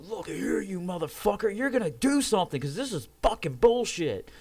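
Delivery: high, loud retold furious demand; all-out angry outburst. Patient in pain curses out idle staff, all-out fury. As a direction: re-enact an outburst with everything you have. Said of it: "with everything I had".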